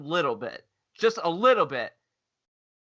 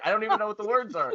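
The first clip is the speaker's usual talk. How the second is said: usual speaking voice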